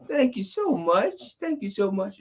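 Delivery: put-on high falsetto old lady, sweetly grateful, slow; old lady thanking. Rich old lady thanking the men at the door, high falsetto. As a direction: gush a dainty thank-you like a frail old lady. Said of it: "And she says".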